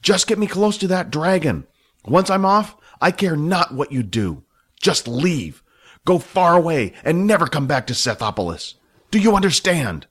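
high, hard commanding villain voice; Iman's cold threat. A narrator's reading. Iman threatening the dragon pilot, menacing commanding voice. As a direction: order him away with menace.

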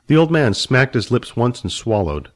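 ordinary delivery

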